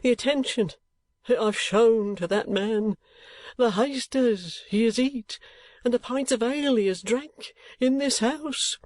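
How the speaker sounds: high, slow, aggrieved lament fading to a whisper; father complaining to the matrons, plaintive; plaintive complaint. A narrator's reading. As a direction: whine it out, wounded. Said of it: "looking round with a plaintive air".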